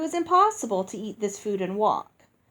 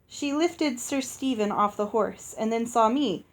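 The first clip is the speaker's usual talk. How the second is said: ordinary delivery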